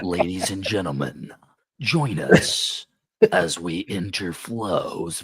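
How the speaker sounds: deep, slow trailer announcer; movie trailer voice. Acted. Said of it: "get my movie trailer voice on".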